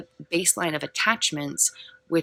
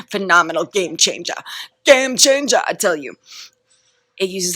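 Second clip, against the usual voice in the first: loud, hyped-up rave; so excited; 2 semitones higher, 8 dB louder than usual. Real, not acted. Speaker plugging own toolkit, loud excited hype. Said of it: "'cause I'm so excited".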